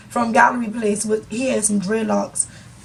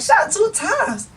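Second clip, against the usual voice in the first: shocked passenger; 7 semitones higher, slower than usual. Retold or imitated.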